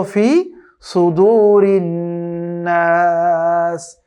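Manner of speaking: drawn-out melodic tajweed chant; slow Quran recitation. Shown as a demonstration. Teacher recites slowly for students to copy. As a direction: recite each syllable long and measured.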